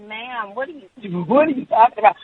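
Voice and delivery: acted-out confused pushback; bewildered reply. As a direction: ask in baffled protest. Own bewildered reply to screamer.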